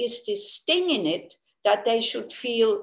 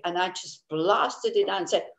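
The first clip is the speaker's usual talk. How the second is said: ordinary talk, nothing marked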